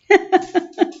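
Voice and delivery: a short high giggle; little high giggle. Real, not acted. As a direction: giggle lightly. Laughs to herself.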